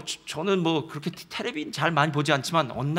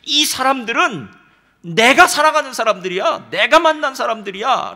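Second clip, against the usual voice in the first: loud declaration; 5 semitones higher, 9 dB louder than usual. Retold or imitated. God declaring love for people.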